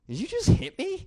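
high-pitched mock disbelief; incredulous. Man to the woman who just hit him, incredulous. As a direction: ask it in high, stunned disbelief. Retold shock at being hit.

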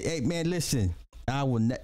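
ordinary talk, nothing marked

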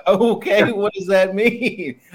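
high, slow incredulous "what does that mean"; drawn-out mock disbelief at the self-styled artist; skeptical reply. Mocking her own reply to an LA artist, incredulous. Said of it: "I'm like".

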